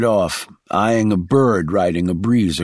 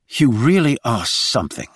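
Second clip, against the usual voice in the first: a touch heated, slightly raised; 3 semitones higher than usual. A narrator's reading. Reggie snapping back at Shaw's critiques, mildly heated. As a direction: let the irritation show a little. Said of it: "she said heatedly".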